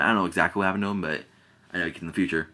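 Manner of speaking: usual speaking voice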